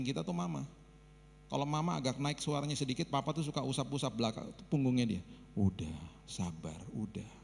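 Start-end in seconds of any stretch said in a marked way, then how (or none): 5.5-7.3 low, slow, soft calming voice; father soothing. Retold or imitated. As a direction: gently hush her, like papa rubbing her back. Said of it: "Itu papa".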